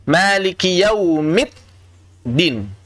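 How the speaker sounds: "Maliki yaumiddin" very slow, as yaumit, gap, din; d turned t. Shown as a demonstration. Teacher shows the common slip, "Maliki yaumiddin" as yaumit-din. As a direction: stop hard on a t inside "Maliki yaumiddin". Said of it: "hati-hati sering jadi ta".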